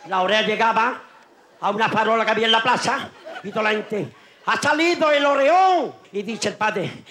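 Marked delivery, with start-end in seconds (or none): 4.4-5.9 crowd shout. Acted. Crowd cries out at boy.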